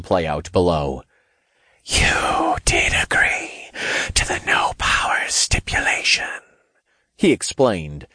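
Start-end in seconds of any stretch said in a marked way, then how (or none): 1.8-6.4 whispered demon voice; ominous, unvoiced hiss of a demon lord; demon king. A narrator's reading.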